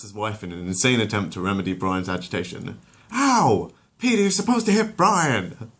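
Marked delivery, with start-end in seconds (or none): 3.1-5.7 high, loud outraged yelp; shocked protest after a slap; Lois yelping. Acted. Lois scolding Peter after he slaps her, high and loud.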